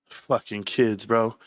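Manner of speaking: low, slow, muttered gripe; grumbling. Retold or imitated. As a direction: grouse under your breath. Grumbles at the kids.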